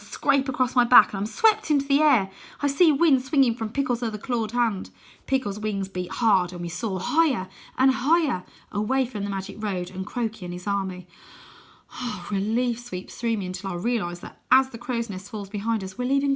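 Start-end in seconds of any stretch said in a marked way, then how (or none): none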